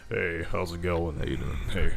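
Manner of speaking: low, soft voice put on for strangers; imitated deep voice. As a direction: drop deep to greet a stranger. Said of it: "a stranger's like".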